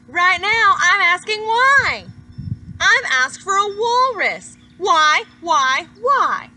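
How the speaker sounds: the pigeon getting worked up, complaining to the reader; ranting pigeon. Acted. Pigeon rants about asking for things.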